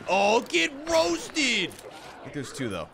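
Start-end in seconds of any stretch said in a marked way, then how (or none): 0.1-1.7 high, slow, drawn-out yell; gloating shout. Real, not acted. Gamer celebrating a headshot, long high gloating shout. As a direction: stretch the taunt into a holler.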